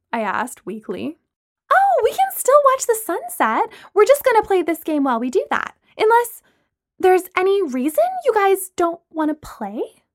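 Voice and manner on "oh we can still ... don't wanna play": high, sing-song fake innocence; mock innocent. A narrator's reading. Lana pressing the group to play her game, feigned innocence. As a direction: play it sweetly innocent while stirring trouble. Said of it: "she asked innocently".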